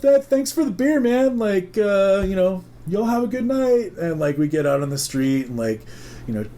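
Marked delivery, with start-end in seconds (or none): 0.0-3.9 high, over-polite excuse to leave; awkward goodbye. Retold or imitated. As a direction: stall with hesitant, pitched-up thanks. Awkward polite exit from the house.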